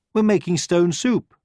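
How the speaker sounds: high bright hen; proud of the soup; cheerful hen. A narrator's reading. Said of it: "said the hen".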